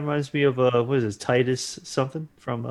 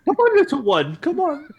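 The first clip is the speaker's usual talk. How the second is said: high coaxing voice; 13 semitones higher, 5 dB louder than usual. Pretend. Coaxing the baby snowman.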